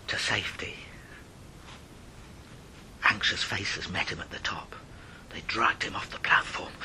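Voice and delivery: soft low whisper; hushed relief. A narrator's reading. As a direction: let the tension drain away.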